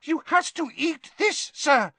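high-pitched, eager elf voice; squeaky house elf. A narrator's reading. Dobby explaining the task to Harry, eagerly squeaky. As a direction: squeak it earnestly. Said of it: "squeaked the elf".

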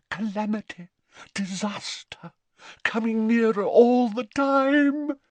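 high, theatrical doom, swelling loud; ominous prophecy. A narrator's reading. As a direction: build from hushed omen to loud warning. Seer intones omens of doom.

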